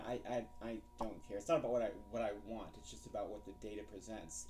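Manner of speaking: normal talking voice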